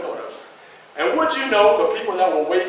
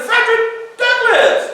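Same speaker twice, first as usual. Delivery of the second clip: high, slow announcing of the name; grand greeting; 13 semitones higher, 4 dB louder, slower than usual. Retold or imitated. Garrison hailing Frederick Douglass, high and slow. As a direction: proclaim his name with delight.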